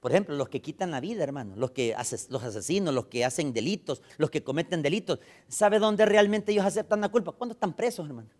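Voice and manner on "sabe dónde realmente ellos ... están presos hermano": loud, high, driving pulpit preaching; preaching at full force. Acted. Pastor preaching loudly about prisoners and guilt. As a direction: thunder it at the congregation.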